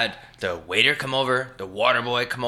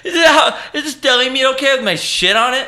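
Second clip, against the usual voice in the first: very high, squealing comic imitation, cracking up; mock deli worker; 12 semitones higher, 4 dB louder than usual. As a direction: push the impression into a laughing squeal.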